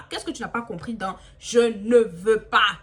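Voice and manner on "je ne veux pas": ne veux pas slowed and loud, each word hammered; drawn-out emphatic «Je ne veux pas»; stressing the refusal. Retold or imitated. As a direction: spell out «Je ne veux pas» slowly and loudly.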